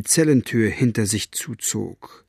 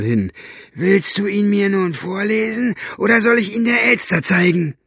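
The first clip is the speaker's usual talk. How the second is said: gruff jailer voice; 5 semitones higher than usual. Acted. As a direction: sneer at him like a brutish guard. Buster taunting, threatening.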